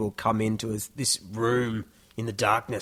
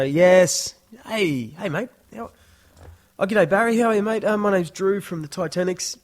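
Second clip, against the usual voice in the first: high, loud, chummy salesman cheer; 8 semitones higher, 6 dB louder than usual. Retold or imitated. Band member sweet-talking the pub boss on the phone, high and chummy. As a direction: greet the pub boss like an old mate to win him over. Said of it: "put on your sales voice".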